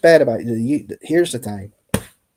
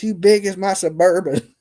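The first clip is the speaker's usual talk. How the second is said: higher mock-angry jab; playful imitation of someone going off; retold insult; 6 semitones higher than usual. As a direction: deliver a borrowed insult for laughs.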